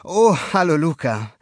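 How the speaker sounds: put-on a startled old fish greeting; lightly voiced neighbor. A narrator's reading. Neighbor recovering from a fright, flustered hello.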